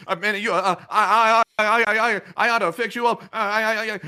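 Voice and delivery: high, loud mock stammer; acted sputtering rage; angry stuttering. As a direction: stutter furiously, getting stuck on the word. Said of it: "and they're angry".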